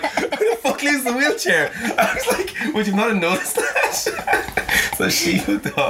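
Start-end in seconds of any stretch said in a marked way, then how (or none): 0.0-3.7 high, laughing incredulous question; laughing disbelief. Retold or imitated. Mocking the woman about the wheelchair, laughing. 3.8-4.9 high laugh. Real, not acted. Laughing at the wheelchair.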